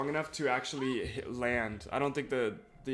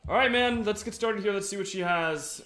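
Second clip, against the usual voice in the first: excited; 7 semitones higher, 5 dB louder than usual. Real, not acted. Hyped start of a game run.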